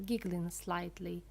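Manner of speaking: ordinary delivery, nothing marked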